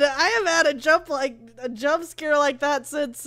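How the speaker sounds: usual speaking voice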